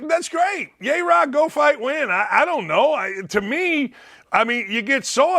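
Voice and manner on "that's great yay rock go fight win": sarcastic cheer. Acted. Mocking cheer at rival's video.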